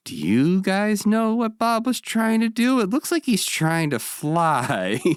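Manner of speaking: very high, slow, drawn-out puppet voice; Big Bird. Retold or imitated. Big Bird asks the camera about Bob's dancing, high puzzled voice. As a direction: pitch it way up and stretch it, innocently puzzled. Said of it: "talks to the camera and he's like".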